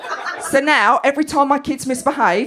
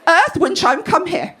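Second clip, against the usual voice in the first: higher mock call to the children; Linda; 5 semitones higher, slower than usual. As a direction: imitate a mum summoning her children. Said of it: "she's there and she's going".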